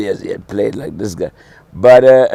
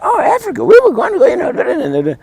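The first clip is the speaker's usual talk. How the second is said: high excited Southern relatives, much higher. Retold or imitated. Imitating Southern aunts gushing over Africa, much higher. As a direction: ham up the amazed relatives.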